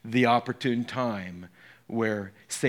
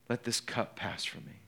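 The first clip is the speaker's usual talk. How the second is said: hushed prayer; 4 semitones lower, 10 dB quieter than usual. Acted. Jesus' prayer repeated.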